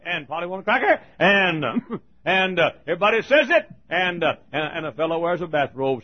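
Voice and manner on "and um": ordinary talk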